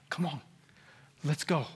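soft, low gentle coaxing; outwardly restrained. Acted. Pastor outwardly urging his church, soft and restrained. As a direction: hold it back, very softly. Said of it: "And outwardly, I'm like".

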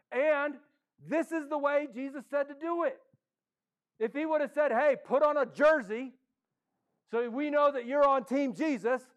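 high, loud rallying sermon voice; rally the congregation to step forward; preacher urging the crowd on; impassioned preaching. Acted. Preaches with rising force.

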